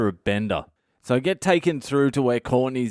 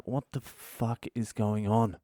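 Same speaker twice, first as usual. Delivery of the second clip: slow quiet disbelief under the breath; quiet bewildered mutter; 8 dB quieter than usual. Retold or imitated. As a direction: mutter it to yourself, lost. Bewildered at cops' offer.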